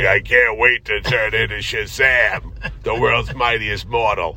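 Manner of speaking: put-on low, slow, overgrown kid voice; grown man playing teen. Podcaster mocking a thirty-year-old cast as a teenager, slow put-on low voice. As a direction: play a too-old actor as an eager teen, dragging it out. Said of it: "a thirty-year-old guy playing a teenage kid".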